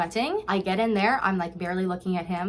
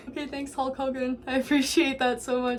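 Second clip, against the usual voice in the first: high, put-on weepy voice; hammed-up teary gratitude; mock tearful thanks; 5 semitones higher than usual. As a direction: play at thanking someone through tears. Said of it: "So I come in just crying".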